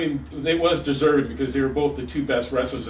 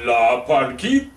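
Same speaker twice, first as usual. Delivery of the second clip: slower, louder announcer call; ring announcer; 3 semitones lower, 4 dB louder, slower than usual. Acted. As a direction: stretch it out like a luchador announcer. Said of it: "hold it like the luchador announcer".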